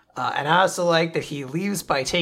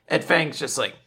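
usual speaking voice

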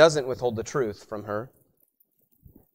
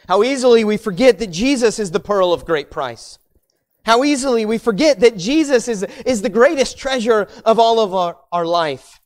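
louder, higher build on "How easily we forget"; rising preacher cry; 7 semitones higher, 6 dB louder than usual. Acted. Preacher pressing the congregation on Christ, rising louder. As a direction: swell it toward the climax.

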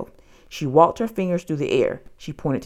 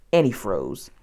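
usual speaking voice

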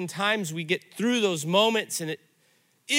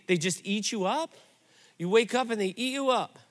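normal talking voice

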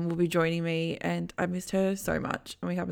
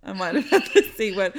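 laughing; 3 semitones higher, 7 dB louder, faster than usual. Real, not acted. Laughing admission.

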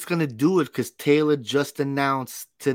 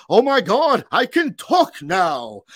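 higher, louder, slow stagey exclamation; overdramatic talking goat; 10 semitones higher, 6 dB louder, slower than usual. Retold or imitated. Imitating the goat's first words for a co-host, hammed-up stage voice. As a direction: ham it up like an astonished theatre goat. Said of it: "This goat's like".

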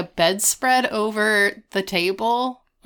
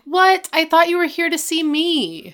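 high, loud daughterly protest; mock indignant surprise; 9 semitones higher, 4 dB louder than usual. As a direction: protest to Dad, pitched right up. Angela protests to her dad.

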